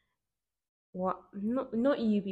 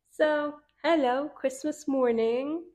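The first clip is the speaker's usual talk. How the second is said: drawn-out greeting; 7 semitones higher, 5 dB louder, slower than usual. Real, not acted. Playful drawn-out greeting to listeners.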